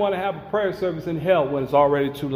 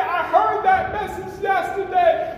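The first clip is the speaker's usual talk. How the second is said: damned soul crying out; 13 semitones higher, slower than usual. Acted. Rich man in hell begging God to get him out, high wailing shout. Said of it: "Now I'm saying".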